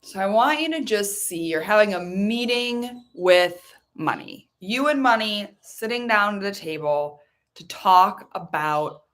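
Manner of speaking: slow, calm guiding voice; guided visualization. Acted. Coach guiding listeners to meet money, slow and calm. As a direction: lead them gently into the image.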